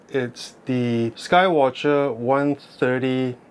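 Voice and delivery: ordinary talk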